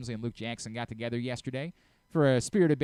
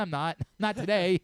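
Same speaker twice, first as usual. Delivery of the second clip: ordinary delivery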